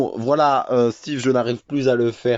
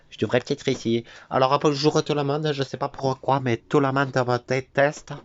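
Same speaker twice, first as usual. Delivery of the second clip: put-on much higher child voice, playful; Oli's voice; 5 semitones higher than usual. Introducing the character Oli to viewers in a high child voice. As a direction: play Oli as a cheeky kid. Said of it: "je vais vous faire un petit peu toutes les voix".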